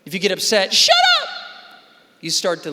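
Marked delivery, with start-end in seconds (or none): 0.7-1.3 shrill shout, much higher and louder; mock yell. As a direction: snap back as the upset kid. Upset victim yelling.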